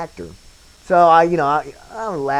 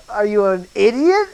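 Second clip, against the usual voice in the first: mocking disbelief, higher and louder; incredulous look; 8 semitones higher, 7 dB louder than usual. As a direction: imitate the director's scornful look. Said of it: "he kinda looks at me like".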